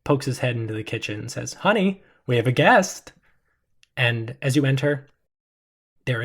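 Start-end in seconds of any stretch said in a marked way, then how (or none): 1.5-3.1 calling out. Acted. Calling to wife in kitchen.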